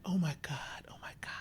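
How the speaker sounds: hushed mock whisper; whispered oh my God. Imitating her whisper to the crew. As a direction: breathe it out like a faked shock. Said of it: "She's like".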